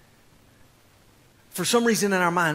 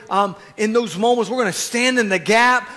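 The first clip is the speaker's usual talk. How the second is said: ordinary delivery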